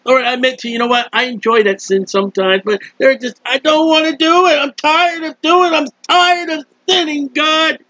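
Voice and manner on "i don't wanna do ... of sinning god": higher, pleading cry of fatigue; weary pleading prayer. Acted. Sinner tired of sin, higher pleading to God. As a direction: beg God, raised and worn out.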